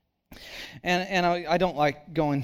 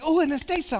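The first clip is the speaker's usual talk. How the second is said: excited wife; 10 semitones higher, faster than usual. Retold or imitated. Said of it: "She's like".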